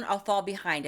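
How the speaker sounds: usual speaking voice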